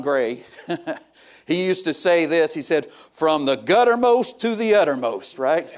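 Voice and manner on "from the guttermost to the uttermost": imitating an old preacher's Arkansas accent, roughly; attempted Arkansas accent. Preacher quoting Brother Leon Gray, attempted Arkansas accent. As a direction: have a loose go at an Arkansas preacher's catchphrase. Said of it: "I can't do an Arkansas accent".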